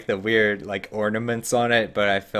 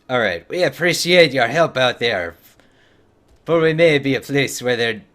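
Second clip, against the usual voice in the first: put-on louder, higher Scottish-dwarf accent on "All right, we appreciate"; 4 semitones higher, 8 dB louder than usual. Streamer reading quest text to chat in a Scottish dwarf accent. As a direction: push a thick Scottish brogue. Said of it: "I'm trying to do the accent".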